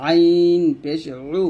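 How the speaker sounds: slow, long আইন squeezed from mid-throat; pressed mid-throat sound. Shown as a demonstration. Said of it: "গলার মাঝখান থেকে চাপ দিয়ে উচ্চারণ করব". (গলার মাঝখান থেকে চেপে আইন পেশ উ)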